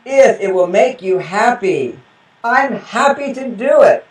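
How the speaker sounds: 천천히 또박또박 말하는 남편; slow and deliberate. Retold or imitated. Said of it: "that's a very male thing".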